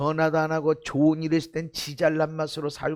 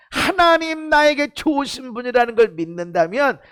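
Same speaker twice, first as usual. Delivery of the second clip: ringing pulpit voice, pressing each point; preaching at full force; 6 semitones higher, 8 dB louder than usual. Acted. Pastor urging the congregation, preaching at full force. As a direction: preach it out to the whole hall.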